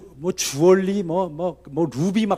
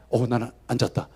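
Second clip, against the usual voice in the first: half-asleep mumble, much softer; groggy patient insisting he stayed awake, low and soft; quiet muttered aside; 6 semitones lower, 7 dB quieter than usual. Retold or imitated. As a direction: murmur 어, 나는 안 잤다 to yourself, much softer. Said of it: "그랬는데".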